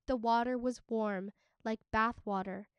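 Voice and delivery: plain reading voice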